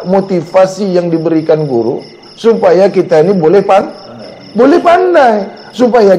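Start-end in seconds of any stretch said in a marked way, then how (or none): none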